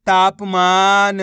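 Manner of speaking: तापमान drawn out very slowly, syllables joined; slow blended word. Shown as a demonstration. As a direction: stretch the whole word out to blend it. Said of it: "तो मिलके बन जाएगा". (धीरे धीरे मिलाकर बोलना)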